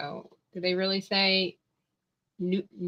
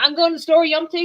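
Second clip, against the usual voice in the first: high, louder country drawl; 7 semitones higher, 7 dB louder, much faster than usual. Retold or imitated. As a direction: put on a rural Southern twang. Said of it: "They mean, like".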